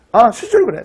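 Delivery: ordinary talk, nothing marked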